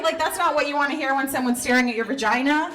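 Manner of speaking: ordinary talk, nothing marked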